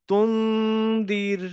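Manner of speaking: তুন্দির slow with the n hidden in a nasal hum; nasal ikhfa. Shown as a demonstration. Teacher modelling ikhfa, slow nasal hum. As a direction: draw out তুন্দির, humming the n. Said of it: "তাহলে এরকম হচ্ছে".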